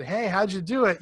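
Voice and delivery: upbeat mock interviewer calling. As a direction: pretend you hold a camera and call out. Imagined interviewer question.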